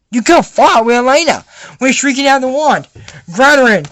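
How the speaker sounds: acted urgent shout; shouted battle order. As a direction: call out the order mid-fight. Acted order during a fight.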